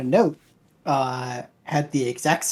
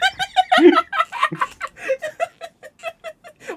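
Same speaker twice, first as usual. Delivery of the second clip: long squealing laughter; high laugh. Real, not acted. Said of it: "laughing hard".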